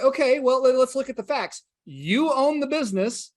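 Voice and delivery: a hint of a coach cornering him; pressing coach. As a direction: lay out the facts like a pushy coach. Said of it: "And he's like".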